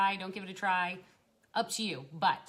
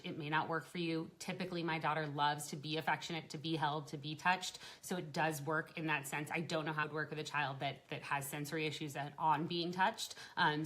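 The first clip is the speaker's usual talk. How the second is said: ordinary talk, nothing marked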